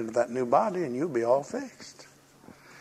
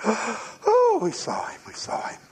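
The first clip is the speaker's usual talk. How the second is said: breathless excitement; 8 semitones higher, slower than usual. Retold or imitated. Disciples' excited gasp to Thomas.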